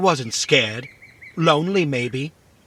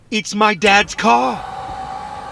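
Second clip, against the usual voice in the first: slow, high, loud cry of recognition; excited shout; 6 semitones higher, 4 dB louder, much slower than usual. Acted. Robot recognising its creator's car, slow excited shout. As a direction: announce it with joy.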